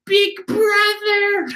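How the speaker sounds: put-on very high, slow, crying-out little girl; little sister voice. Young Brulee calling to her big brother, high and small. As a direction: stretch it out like a tiny sister calling. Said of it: "when I use that voice".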